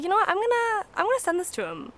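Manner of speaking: high-pitched, eager announcement; retold eager resolve. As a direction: act out a sudden bright idea. Acting out her sudden decision.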